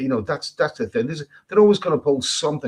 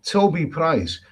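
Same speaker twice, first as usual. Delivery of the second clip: ordinary talk, nothing marked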